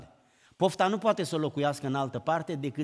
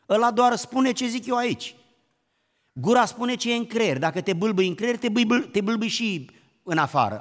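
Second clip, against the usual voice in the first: louder, rising preacher's push; fervent preaching; 4 semitones higher, 5 dB louder than usual. Acted. Preacher to church, on the stammering mind, fervently preaching. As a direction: drive the point home from the pulpit.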